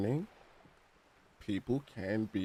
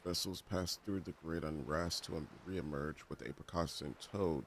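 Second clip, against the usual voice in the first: much softer, fast mumbled reading; soft reread; 3 semitones lower, 10 dB quieter than usual. Shown as a demonstration. Player rereading the NPC's line to himself, soft mumble. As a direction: read it back under your breath. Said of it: "rereads NPC line softly".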